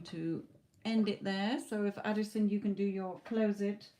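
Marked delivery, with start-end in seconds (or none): none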